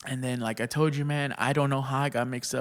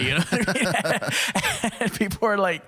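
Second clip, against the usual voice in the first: laughing; 8 semitones higher, 5 dB louder than usual. Real, not acted. Laughing while telling story.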